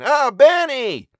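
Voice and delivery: slow, very loud, high drawn-out call; long calling out. Acted. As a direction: stretch his name out across the field. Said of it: "she called again".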